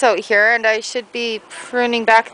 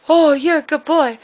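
higher, fond dog praise; praising dog; 5 semitones higher, 4 dB louder than usual. Real, not acted. Owner praising her dog for sitting, high fond voice. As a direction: tell the dog he's good.

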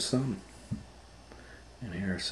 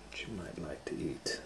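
soft unvoiced whisper; 12 dB quieter than usual. Real, not acted. Whispering to children about tasty food. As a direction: lean in and whisper it like a secret.

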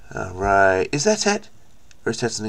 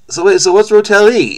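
surprised; 8 semitones higher, 6 dB louder than usual. Real, not acted. Sudden surprise at search result.

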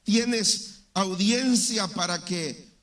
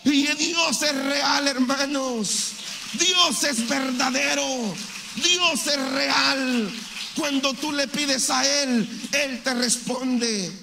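slow emphatic preaching; 2 semitones higher, slower than usual. Acted. Preacher testifying to the congregation, slow and emphatic. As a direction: draw out each word, testifying with conviction.

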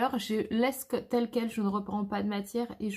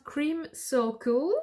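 shade name said slowly with an English accent; 3 semitones higher, much slower than usual. Shown as a demonstration. Presenting the shade to her viewers, slow English accent. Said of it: "vous avez vu l'accent".

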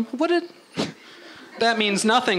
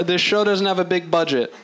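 ordinary delivery

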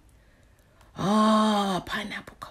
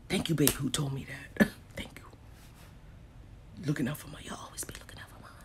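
soft breathy-free whisper of thanks; whispering thanks to a viewer; 7 semitones lower, 14 dB quieter than usual. Real, not acted. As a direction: whisper it close to the mic. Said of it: "Why you whispered?".

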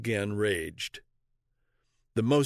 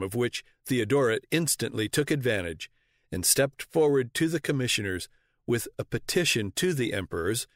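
usual speaking voice

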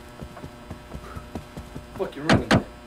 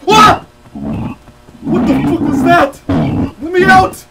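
sudden shriek, much higher and louder; real terrified screaming; 8 semitones higher, 11 dB louder than usual. Real, not acted. Player jump-scared by the monster, screaming in real panic. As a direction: scream as the monster appears out of nowhere.

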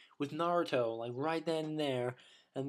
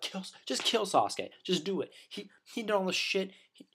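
mock villagers; 6 semitones higher than usual. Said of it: "They're just like".

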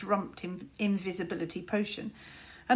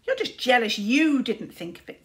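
put-on louder, childish taunt; 3 semitones higher, 7 dB louder than usual. A narrator's reading. Tsar taunting Luther, louder. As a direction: needle your big brother. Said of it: "said Tsar".

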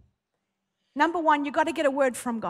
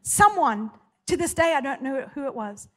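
ordinary talk

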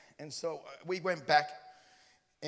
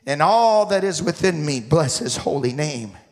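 preaching; 13 dB louder than usual. Retold or imitated. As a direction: preach it out at full voice. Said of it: "And I was preaching".

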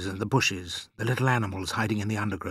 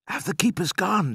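put-on questioning boy; 10 semitones higher, 3 dB louder than usual. A narrator's reading. Boy questions father in pit.

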